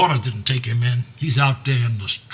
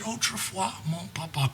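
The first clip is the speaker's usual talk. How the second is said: French words; 5 semitones higher, 10 dB quieter than usual. Acted. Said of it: "Her thing was French".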